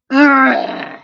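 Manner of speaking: high, loud crying ugh after a fall; pained groan. A narrator's reading. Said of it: "groaned Sammy".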